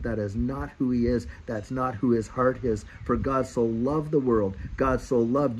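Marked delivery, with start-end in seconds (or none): none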